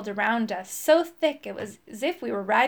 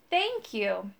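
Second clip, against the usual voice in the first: put-on quieter old woman voice; second thanks, softer and breathier; 4 dB quieter than usual. A narrator's reading. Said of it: "she gasped".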